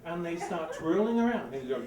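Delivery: ordinary talk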